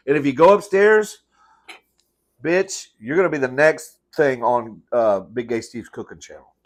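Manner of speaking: loud threatening scold. Real, not acted. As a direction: warn the dog with a joking threat. Threatening the dog.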